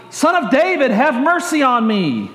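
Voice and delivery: high opening, falling plea; mild crying out. A narrator's reading. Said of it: "he cried out even more loudly".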